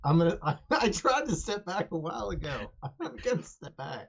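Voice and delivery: high-pitched protest through laughter; laughing protest. Acted. Claude answering the nervous guard, laughing. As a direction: protest in character while cracking up.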